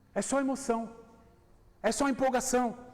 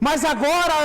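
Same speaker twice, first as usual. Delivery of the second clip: loud outburst; 10 dB louder than usual. Real, not acted. Preacher shouts to wake the congregation. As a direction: burst out loud on agora.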